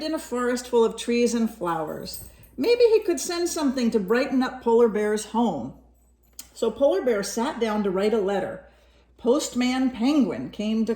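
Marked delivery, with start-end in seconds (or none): none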